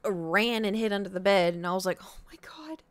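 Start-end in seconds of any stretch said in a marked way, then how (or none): 2.0-2.8 whispered exasperation. Retold or imitated. Exasperated at cat.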